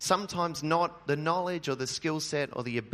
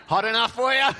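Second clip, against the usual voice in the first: laughing, higher and louder cheesy weather small talk; that small-talk guy; 7 semitones higher, 7 dB louder than usual. Pretend. Pastor jokes with the congregation as the weather-cliché guy, laughing. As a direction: ham up the corny hot-weather cliché. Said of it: "I just thought I'd be that guy".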